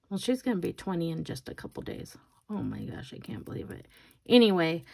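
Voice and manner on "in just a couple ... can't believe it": hushed disbelief under the breath; whispered aside. Real, not acted. Mom marvels at daughter nearly twenty, whispering. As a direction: whisper it to yourself.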